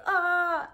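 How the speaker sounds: high, loud, sustained "ah"; a held "ah" well above talking pitch; demonstrated "ah" sound. Shown as a demonstration. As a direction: call out one high vowel for a baby. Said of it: "You can just say".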